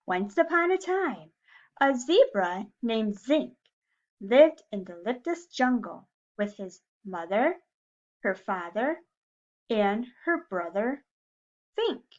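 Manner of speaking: put-on reading to children. A narrator's reading. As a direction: read it like story time for little kids. Reading a picture book to class.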